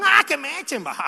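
carefree youth. Retold or imitated. Preacher mimics a careless young man, mock carefree. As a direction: shrug it off like a careless kid. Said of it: "Cuando uno está joven".

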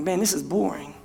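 bored. Retold or imitated. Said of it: "I'm like".

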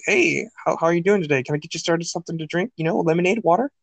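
lightly put-on chirpy server greeting. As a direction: lightly imitate a server taking drink orders. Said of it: "your server voice".

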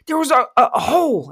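high, loud stammered outburst; acted agitation, stumbling over the words; flustered. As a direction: voice jumps up, struggling to say it. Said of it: "now I'm all flustered".

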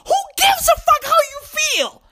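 high-pitched exasperated outburst; fired-up preaching. Real, not acted. Scolding a self-centred parent, high preachy outburst. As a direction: throw it back at them, way up high. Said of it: "I'm preaching this week".

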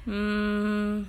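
thoughtful hum; thinking hmm. Real, not acted. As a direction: hum while thinking it over. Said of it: "Déjame pensarlo bien".

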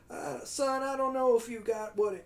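high, slow southern molasses drawl; hammed-up southern boss, pitched high; Al Pacino, southern accent. Boss doubts his young employee, mock southern drawl. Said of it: "He's kinda doing a southern, like, molasses".

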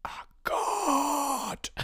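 very high, drawn-out proclamation; hammed-up cry of self-proclaimed godhood; mock prophet. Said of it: "Pretend you're a prophet".

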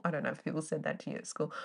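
normal talking voice

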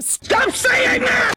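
drawn-out, high-pitched shouted outburst; mock furious yell. Retelling the devil losing patience, exaggerated yell. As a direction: explode loudly, stretching each word, hammed-up. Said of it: "She yells at him".

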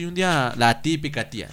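usual speaking voice

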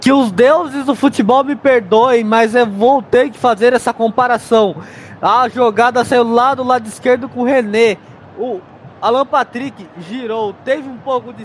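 booming, emphatic studio analysis; loud commentary. Real, not acted. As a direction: project every word like a pundit.

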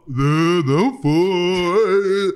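loud, drawn-out mock protest; mocking retold quote. Host imitates a man answering after playing audio, mocking drawl. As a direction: stretch it out slowly, imitating him. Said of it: "Aí ele toca e fala".

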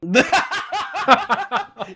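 big squealing burst of laughter; high laugh. Real, not acted. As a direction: crack up, high and loud. Host laughs at a joke.

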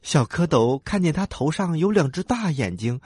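usual speaking voice

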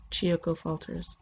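ordinary delivery

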